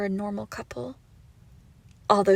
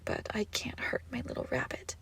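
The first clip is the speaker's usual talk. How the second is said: a much softer whisper; tender whisper; 3 semitones higher, 10 dB quieter than usual. Acted. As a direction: whisper it like a secret kindness. Tender turn after threats.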